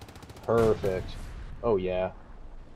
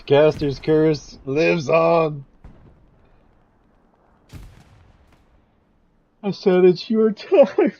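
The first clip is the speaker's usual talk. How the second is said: loud laughing shouts, high pitched; shocked laughter; 4 semitones higher, 8 dB louder, slower than usual. Real, not acted. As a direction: howl it out mid-laugh.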